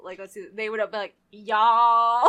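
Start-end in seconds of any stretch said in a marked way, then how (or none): 1.3-2.3 loud, long-drawn Southern y'all; mock a Southern drawl, really loud; exaggerated Southern accent. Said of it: "exaggerate a Southern accent".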